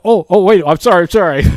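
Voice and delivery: loud, high flustered apology. Retold or imitated. Pastor catching his own rudeness, flustered. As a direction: scramble to take it back. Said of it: "And I thought".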